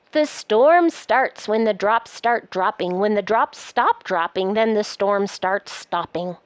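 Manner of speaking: lively, sing-song picture-book reading; reading to children. Acted. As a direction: read it aloud like a bedtime book. Reads the book aloud.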